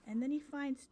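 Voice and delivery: normal talking voice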